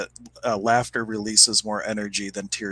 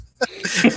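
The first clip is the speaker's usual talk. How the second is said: breathy high laugh; 12 semitones higher than usual. Real, not acted. Laughing at the punchline.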